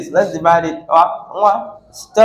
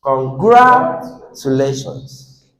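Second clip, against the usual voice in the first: con-GRA-tulations, GRA raised and drawn out; stress on second syllable; 5 semitones lower, slower than usual. Shown as a demonstration. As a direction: slowly, sustain GRA in "congratulations". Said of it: "Now let's continue".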